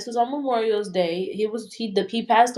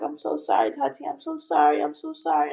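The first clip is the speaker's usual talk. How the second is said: imitating a friend's upset apology, higher; retold tearful apology; 4 semitones higher than usual. Friend calling with terrible news, imitated tearful apology. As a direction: repeat it in a higher, distressed voice. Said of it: "she was crying".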